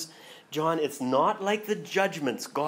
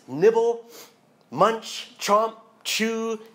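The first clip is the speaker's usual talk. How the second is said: higher, louder, punchy food verbs; eager chewing words; 8 semitones higher, 6 dB louder, much slower than usual. Acted. Preacher urging the congregation to chew on Scripture, punchy and louder. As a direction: bite off each verb with relish.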